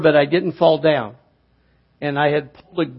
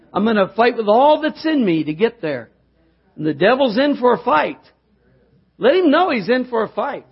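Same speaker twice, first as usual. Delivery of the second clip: higher, building preacher's cry; rising preaching; 6 semitones higher than usual. Acted. Preacher rallying the congregation against the devil, building. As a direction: lift the church with each line.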